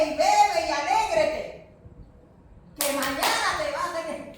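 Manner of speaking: loud, high preacher's cry; impassioned sermon delivery; fiery preaching. Real, not acted. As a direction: preach it at full force to the congregation. Preaching at full force.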